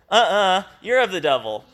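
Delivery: mock kindergarten nuh-uh taunt; childish retort. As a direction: throw it back like a sulky kid. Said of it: "Sounds like a bunch of kindergarten kids".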